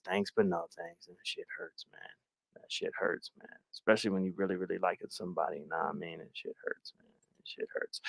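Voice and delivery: very low, hushed, wounded mumbling to self; quiet, crestfallen aside; hurt, nearly whispered. Real, not acted. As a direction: sink into a deflated near-whisper. Wounded by a romantic rejection.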